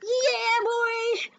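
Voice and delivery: high, loud whoop of mock ecstasy; hammed-up cheer, drawn out; ecstatic. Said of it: "you see them get ecstatic".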